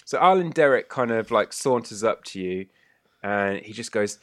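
ordinary talk, nothing marked

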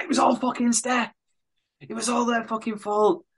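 mock dying words. Mitch blaming Steph with his supposed last breath, hammed up. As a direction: gasp out the blame, hammed up. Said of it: "what he thought were his dying words".

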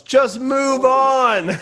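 slow, high, drawn-out heckle from the back; impatient heckler calling out; heckler shout. Retold or imitated. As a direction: yell it across the room at the stage. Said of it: "some guy in the back goes".

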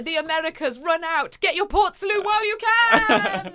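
high, loud playful call-out; mock rallying call. Host urging Americans to buy Port Salut, mock rallying cry.

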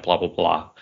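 ordinary delivery

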